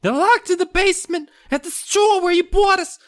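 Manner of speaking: very high little gnome voice; squeaky gnome. Acted. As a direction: chirp it like a tiny gnome. Gnome voice continues.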